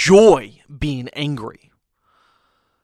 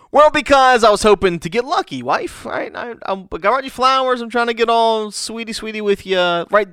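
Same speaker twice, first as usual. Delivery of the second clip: husband teasing his wife, higher and playfully sly; cheeky husband; 8 semitones higher than usual. Acted. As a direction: sell the flowers with a wink. Husband's cheeky reply in skit.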